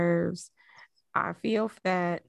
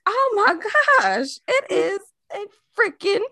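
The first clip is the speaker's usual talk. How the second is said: retold amazement; 11 semitones higher, 3 dB louder than usual. Caught in the wind.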